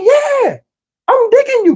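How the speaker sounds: high-pitched eager woman, acted out; mock falsetto agreement; imitated woman's reply. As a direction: answer in a high, drawn-out girlish voice.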